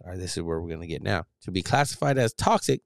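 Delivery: ordinary talk, nothing marked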